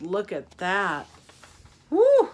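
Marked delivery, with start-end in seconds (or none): 1.9-2.3 high, loud little whoop; exclaimed woo. Acted. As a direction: whoop at the shock of cold. Reader reacts to the cold.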